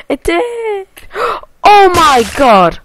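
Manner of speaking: high squeal of amazement between gasps; gasp, squeak, then burst out; astonished gasping. Real, not acted. Gamer discovering his hat came along, astonished.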